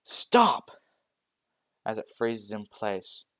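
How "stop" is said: very loud high command; scream. Acted. Deku ordering the Nomu to stop, loud scream. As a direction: scream it to freeze the beast.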